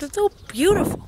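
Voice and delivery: It's so beautiful, high and rising; sad longing. Real, not acted. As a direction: ache over the view as you leave. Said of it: "I'm so sad".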